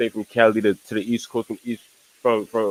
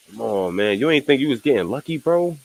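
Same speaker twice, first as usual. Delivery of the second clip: ordinary talk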